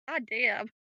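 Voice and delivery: ordinary delivery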